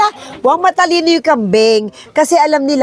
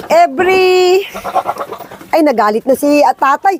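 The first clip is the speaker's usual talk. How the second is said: usual speaking voice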